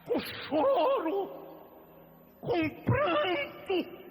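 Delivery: slow pleading shout; drawn-out cry. Acted. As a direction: stretch it out as a crying plea. Preacher crying out about weeping.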